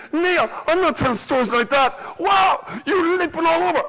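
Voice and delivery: high, loud, exasperated squeal; shouted mock complaint; mock complaint from a listener. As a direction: squeal it like an outraged fan. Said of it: "That's my style".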